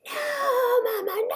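high, drawn-out mock wail; imitated child's scream. Mother retelling her son's struggle in the doctor's room, screaming. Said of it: "He's screaming".

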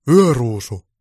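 loud bellowed name; roar. Acted. Santa bangs on the door and roars for the elf. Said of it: "ja karjaisi".

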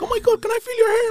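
high, gushing fan voice; excited admirer. Retold or imitated. Partygoer asking to touch his hair, excited mock squeal. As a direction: squeal it like a starstruck stranger. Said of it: "People just come up to me like".

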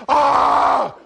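loud, high open-throated yell; mock angry yell. Husband showing how his argument looks from outside, mock yell. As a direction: burst out in a hammed-up roar. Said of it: "But it looks like".

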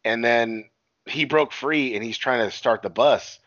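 usual speaking voice

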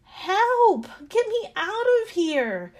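high, loud cry for rescue; yelling for help. A narrator's reading. Clay trapped in the hot oven, yelling for help. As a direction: yell as if pounding on a locked door. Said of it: "I yelled and knocked and pounded at the door".

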